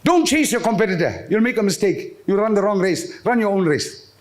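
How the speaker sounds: usual speaking voice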